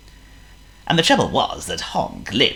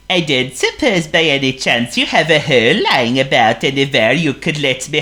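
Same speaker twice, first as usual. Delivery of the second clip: Honk the seal; 8 semitones higher, 7 dB louder than usual. Acted. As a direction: play a polite silly seal. Honk's voice.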